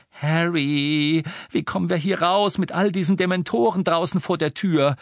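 put-on high, worried girl's voice; anxious young witch. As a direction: ask in alarm. Hermione anxious question.

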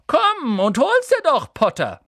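high, loud, slow taunt; calling down. Acted. Malfoy calling down to Harry, loud taunt. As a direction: call it down from the tree. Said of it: "rief er".

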